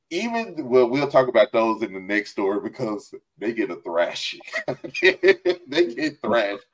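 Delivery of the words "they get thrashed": slow words broken by laughter; laughing it out, slowly; chuckling through the words. Real, not acted. Laughing about the next story.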